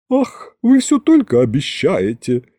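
томно, манерно, с протяжным вздохом; вздохни кокетливо и разочарованно; томный вздох. A narrator's reading. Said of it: "тихо и томно вздохнул Гюнтер". (томно вздыхая)

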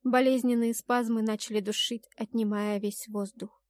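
ordinary delivery, nothing marked

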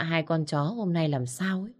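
ordinary delivery, nothing marked

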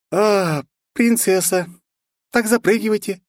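high sing-song invite. A narrator's reading. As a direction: lilt it like a cheerful girl. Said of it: "пропел знакомый голосок". (напевно)

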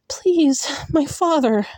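high, pleading cry for mercy; desperate plea. Acted. Heroine begging the fae for her father, high and desperate. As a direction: beg him to spare her father.

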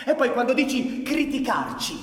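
high, loud indignant shouting with a bitter laugh; heated shout at an old comrade; angry outburst. Acted. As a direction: fire back at him, offended.

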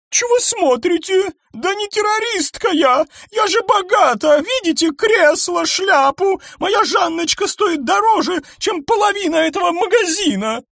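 high, loud screeching protest; indignant rich lady. A narrator's reading. Eleonora screeches at the suspicious crowd. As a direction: shriek your wealth at them.

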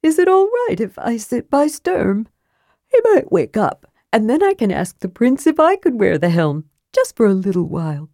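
put-on a bouncy childlike kender asking a favour; Tass the kender.

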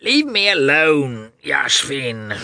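high, loud, drawn-out protest; angry. A narrator's reading. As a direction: snap angrily at someone pestering you, stretching the words. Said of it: "he said angrily to Yashvin".

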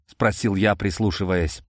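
usual speaking voice

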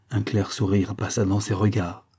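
plain reading voice

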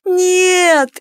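drawn-out cry. A narrator's reading. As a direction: stretch the word in anguish. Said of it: "протянула я". (протяжный выкрик «нет»)

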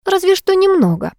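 high, coy, flirtatious concession; sly and playful, with a smile in the voice. Acted. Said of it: "хитро улыбнулась".

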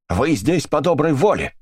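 high, pushed accusing shout; angry shout. A narrator's reading. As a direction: fling it at her. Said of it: "крикнула миссис Брикстер".

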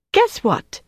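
high, eager teaser; playful high-pitched lead-in to a surprise; bright announcement. A narrator's reading. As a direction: pitch it high, as if bringing good news. Wife voiced brightly teasing her husband.

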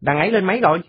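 put-on high, brash little boy; cocky kid. Còi asks the new boy his age, high and brash. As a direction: size him up with a squeak. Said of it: "Còi hỏi".

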